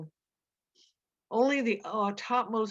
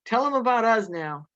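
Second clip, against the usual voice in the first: eager, raised joyful plea; excitedly asking for the story; happy; 5 semitones higher, 7 dB louder than usual. A narrator's reading. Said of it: "said Lenny happily".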